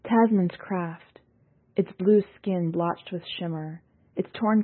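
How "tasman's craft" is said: plain reading voice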